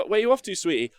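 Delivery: sing-song question to a loved one; partner asking. Pretend.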